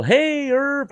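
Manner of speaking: high, loud hey to Irv; calling out. Retold or imitated. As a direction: shout a greeting from the bike. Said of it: "and I'd yell".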